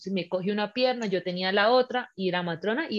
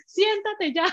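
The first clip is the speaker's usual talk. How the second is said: much higher, acted yell to sit; retold exasperated order; 11 semitones higher, slower than usual. Labouring woman orders hovering partner to sit, acted exasperated yell. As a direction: act out losing patience.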